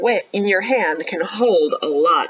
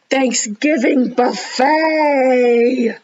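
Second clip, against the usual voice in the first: slow, loud, higher exclamation; excited reveal; 4 semitones higher, 4 dB louder, much slower than usual. Real, not acted. Reader showing children the buffet picture, excited. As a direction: savor the reveal like a treat.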